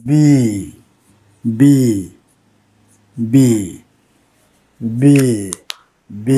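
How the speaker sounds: "B" repeated alone with pauses; teacher drilling the letter "B"; sound demonstration. Shown as a demonstration. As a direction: sound out "B" from closed lips into a long "ee". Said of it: "If we pronounce B".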